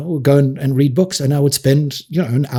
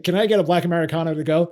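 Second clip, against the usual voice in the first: put-on American accent; 3 semitones higher, faster than usual. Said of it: "ordering coffee in an American accent".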